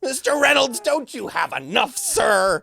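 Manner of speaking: high, loud, mock-despairing cries; hammed-up heartbroken yelling; anguished shout. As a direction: wail at Ryan Reynolds in mock betrayal. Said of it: "And I went".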